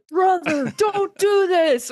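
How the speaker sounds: high, loud, slow pleading shout; pleading Rick. Retold or imitated. Retelling Rick begging Shane at the barn, a high slow shout. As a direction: beg your brother to stop, full voice.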